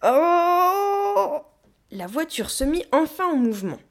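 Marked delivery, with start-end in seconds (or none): 0.0-1.4 high, loud drawn-out effort yell; long straining cry. A narrator's reading. Gorilla shoves the caravan, crying out with effort. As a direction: heave with all your might. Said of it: "rugit la gorille sous l'effort".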